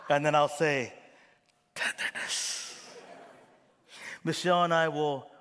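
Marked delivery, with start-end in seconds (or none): 1.7-2.8 whispered word. Shown as a demonstration. Pastor whispers his calm word before a fight. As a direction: breathe the word out gently to calm yourself.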